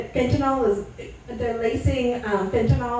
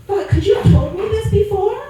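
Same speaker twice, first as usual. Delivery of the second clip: exasperated retort; 8 semitones higher, 4 dB louder than usual. Retold or imitated. Retorting to heroin-using boyfriend.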